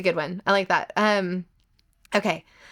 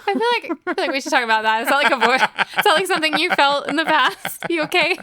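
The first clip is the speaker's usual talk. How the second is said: mock-concerned teasing; 9 semitones higher, 5 dB louder than usual. Teasing co-host about being hungry.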